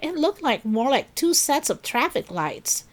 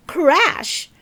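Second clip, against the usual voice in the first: dramatic crash; 8 semitones higher, 6 dB louder than usual. A narrator's reading. Narrator sound word.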